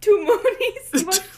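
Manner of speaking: high, loud disbelief; shocked, voice jumps up; surprised. Real, not acted. Disbelief at two movies.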